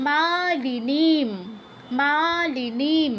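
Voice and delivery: মালিনীম with long মা and নী; long vowels. Shown as a demonstration. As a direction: stretch মা and নী in মালিনীম slowly. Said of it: "মাতে নিতে দীর্ঘ হবে".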